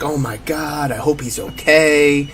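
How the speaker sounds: subdued, softly worried fan; mock distraught fan. Fans watching an injured player, mock distraught. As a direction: imitate a hushed, concerned crowd member. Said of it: "like, being distraught".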